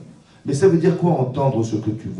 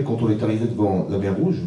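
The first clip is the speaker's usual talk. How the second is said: normal talking voice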